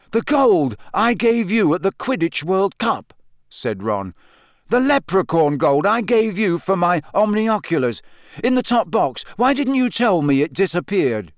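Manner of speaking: upset Ron. A narrator's reading. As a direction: accuse him, wounded. Ron confronting Harry.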